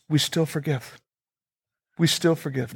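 softly repeated. Real, not acted. Pastor pressing the point to the congregation, softly. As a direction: let it drop to a quiet insistence.

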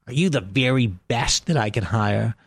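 slow, put-on posh English accent for the producer; faux English accent. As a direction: drawl it like a stuffy English producer. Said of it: "so I can do this faux accent".

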